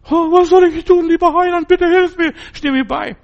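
hammed-up high, loud, whiny plea; mock whining believer. Preacher mocking believers who beg the Saviour for help, in a high whiny wail.

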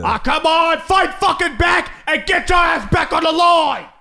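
high, loud British chef shout; Gordon Ramsay. Retold or imitated. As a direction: bark at him like a raging chef. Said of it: "Gordon Ramsay".

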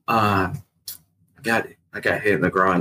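slow, halting start; retold reluctant admission. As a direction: drag out the admission, hesitating. Reluctant friend admitting his injury.

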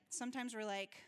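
ordinary delivery

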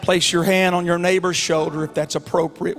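calm invitation. Acted. Pastor asking congregation to pray, hushed.

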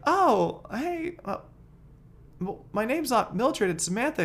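high put-on woman's voice, slow and puzzled; woman's reply. Woman correcting the man who called her, high put-on voice. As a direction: play her, high and unsure. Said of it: "She's like".

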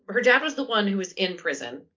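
usual speaking voice

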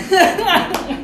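high burst of giggles; a laugh breaking out; stifled laugh. Real, not acted. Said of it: "No, no te rías".

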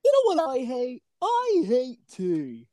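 lightly put-on British accent, slow and drawn out; a hint of a British accent, stretched slowly; mock British. Podcast host teasing co-host as a British tea lover, mock British. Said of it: "We got over here a British".

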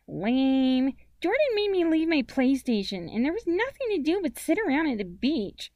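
put-on high, loud child's grumble; high-pitched, sulky little girl; complaining little sister. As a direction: gripe like a put-out nine-year-old. Voices Amy complaining.

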